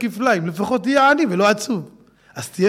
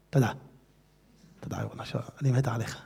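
much softer, low, intimate murmur; hushed thanks; 11 semitones lower, 14 dB quieter, faster than usual. Real, not acted. Speaker quietly thanking God, hushed and tender. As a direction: drop to a tender near-whisper.

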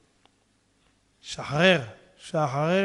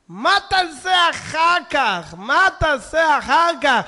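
slow, loud, raised-pitch lament; preacher's outcry; 7 semitones higher, 8 dB louder, much slower than usual. Real, not acted. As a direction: cry out each word as a warning. Preacher repeats a warning question. (זעקת אזהרה איטית ורמה)

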